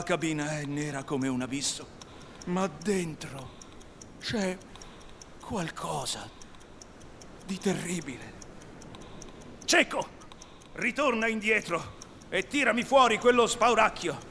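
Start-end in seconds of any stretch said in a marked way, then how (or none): 2.5-8.3 halting, understated dread; terrified. A narrator's reading. Italian pirate back from the dark cabin, terrified. 9.6-14.2 high-pitched captain's order; stern command. Acted. Hook sends Cecco back to the cabin, stern command.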